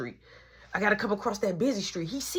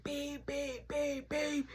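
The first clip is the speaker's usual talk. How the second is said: high, soft car beeps; imitated car horn; 7 semitones higher, 10 dB quieter, much slower than usual. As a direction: mimic honking in a small high voice. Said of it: "So the car like".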